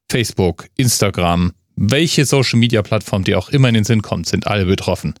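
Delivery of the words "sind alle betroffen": ordinary talk, nothing marked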